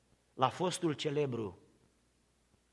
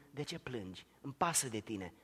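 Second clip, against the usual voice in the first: much softer, lower, caring; tender voice of Jesus; 3 semitones lower, 11 dB quieter than usual. Acted. Jesus to weeping Mary, hushed and tender. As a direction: whisper comfort to her.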